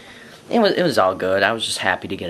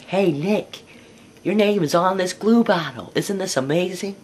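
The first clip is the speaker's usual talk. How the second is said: much higher, calling out eagerly; excited yelling; 10 semitones higher than usual. Retold or imitated. Kid calling to Nick across the room, excited higher yell. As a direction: shout across the classroom with glee. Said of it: "don't you yell across the room like that".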